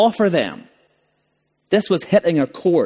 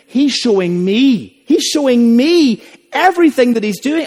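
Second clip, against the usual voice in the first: emphatic preaching; 7 semitones higher, 5 dB louder than usual. Acted. Preacher voicing Jesus' words.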